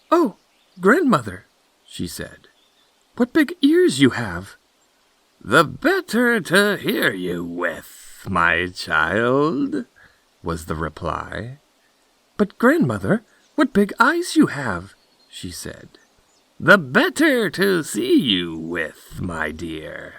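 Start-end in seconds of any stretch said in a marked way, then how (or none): 0.1-1.5 put-on high, loud girlish exclamation; astonished Little Red Cap. Red Cap marvels at grandmother. 3.2-4.6 put-on high, loud girlish wonder; astonished Little Red Cap. Red Cap marvels at grandmother. 5.4-9.9 slow, high put-on granny voice; wolf posing as grandmother. 12.4-14.9 put-on high, loud little girl voice; astonished Little Red Cap. Red Cap marvels at grandmother. 16.6-20.2 wolf posing as grandmother. Acted.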